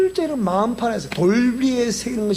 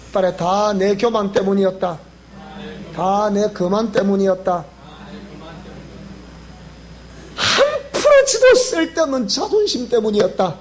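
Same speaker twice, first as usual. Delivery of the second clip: humble confession; 5 semitones lower than usual. Shown as a demonstration. 설교자 회개 고백 시범.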